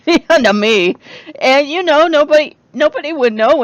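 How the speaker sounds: high, loud words through real laughter; laughing. Real, not acted. Laughing at her own joke.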